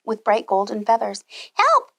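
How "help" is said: shrill, much higher, loud hen's call; clucking hen cry. Acted. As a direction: squawk it like a frightened hen. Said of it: "she clucked".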